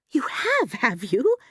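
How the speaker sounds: mildly startled, starting high and dropping; understated startled question. A narrator's reading. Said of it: "more startled than ever".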